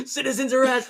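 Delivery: high, loud mock outrage; protesting tiny mouse. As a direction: declare it as an outraged little mouse. Acted mouse making an arrest.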